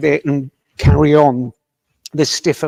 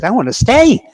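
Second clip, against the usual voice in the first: raised and higher, insisting he wants to stay; indignant; 7 semitones higher, 7 dB louder than usual. Retold or imitated. Dying father to his family, indignant protest. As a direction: protest it, stung and firm. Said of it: "he was quite indignant".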